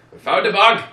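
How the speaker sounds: high, very loud imitated yell; mock alarmed shout. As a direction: shout the discovery as the retold villains. Acting out Nazis finding the bug.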